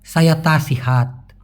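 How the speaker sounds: slow, careful Malay phrase; slow teaching read; slow model phrase. Shown as a demonstration. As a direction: say it slowly for learners. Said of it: "বলতে হবে".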